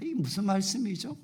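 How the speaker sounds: ordinary talk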